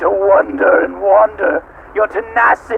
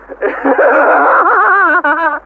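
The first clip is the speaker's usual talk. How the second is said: higher mocking laugh; evil laugh; 8 semitones higher than usual. Machine god laughing at its captives. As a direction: laugh in their faces.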